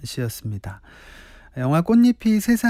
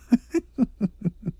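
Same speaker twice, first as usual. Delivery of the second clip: laughing; 7 semitones higher than usual. Real, not acted. DJ amused by a listener's message.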